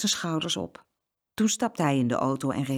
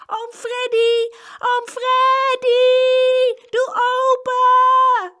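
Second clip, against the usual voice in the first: very high, long-drawn screaming shout; desperate calling; 10 dB louder, much slower than usual. A narrator's reading. Trapped twins calling their uncle to open up, shouting. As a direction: scream for help, stretching every word. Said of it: "het geroep van de tweeling".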